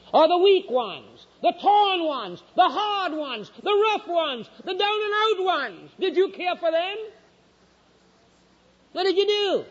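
high, ringing challenge from the pulpit; slow, high, insistent questioning; impassioned preaching. Real, not acted. Challenges congregation with raised voice.

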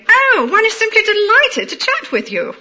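high-pitched upper-class London, exaggerated vowels; upper-class London accent. Shown as a demonstration. Demonstrating how the upper class greet someone, upper-class London accent. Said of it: "they tend to exaggerate their vowels".

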